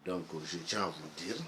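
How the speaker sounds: ordinary delivery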